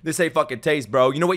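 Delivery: normal talking voice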